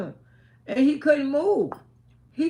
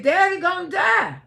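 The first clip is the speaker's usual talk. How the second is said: higher, mildly raised mock holler; retold hollering; 5 semitones higher, 4 dB louder than usual. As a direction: re-enact a distressed cry without a full scream. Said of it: "I started screaming and hollering".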